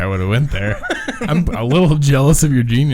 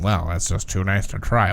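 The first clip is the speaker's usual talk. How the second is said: lightly voiced square, lower pitch; 6 semitones lower, 4 dB quieter than usual.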